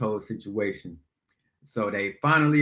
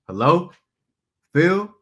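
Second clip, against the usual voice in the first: louder, high call into the flat; 6 semitones higher, 6 dB louder than usual. Retold or imitated. Jill into Phil's apartment, calling louder and high. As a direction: call his name into an empty room.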